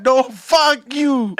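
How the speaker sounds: high, loud, very drawn-out mock kid cursing; defiant kid. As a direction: stretch it out like a bratty little kid. Retold kid cursing at grandpa.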